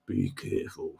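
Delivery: low, hushed menace in a mimicked accent; mock threatening. [name] taking off his accent, mock threatening. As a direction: drop it low and soft, like a veiled threat. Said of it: "sounds like a threat".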